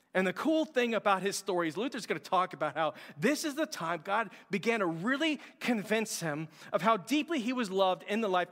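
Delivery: ordinary talk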